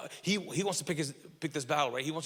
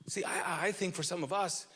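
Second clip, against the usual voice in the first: ordinary delivery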